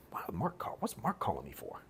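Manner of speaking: hushed whisper to a friend beside him; baffled whisper about the incoming call. Retold or imitated. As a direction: whisper it so the caller can't hear.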